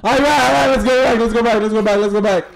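high, loud panicked commands; frantic escape calls; urgent shouting. Real, not acted. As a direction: hurry the team out. Fleeing in panic.

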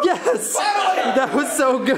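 laughing, thrilled shout of joy; excited cheer. Real, not acted. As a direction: cheer with a laugh, slowed right down. Trick shot finally lands.

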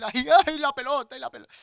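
put-on high, loud child's wail; hammed-up child's tantrum; upset child. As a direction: whine loudly like a child upset over his ball. Imitates upset son wailing over ball.

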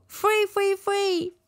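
"free, free" sung out high, r barely formed; says w for r. Real, not acted. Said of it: "I can't say the R's in English".

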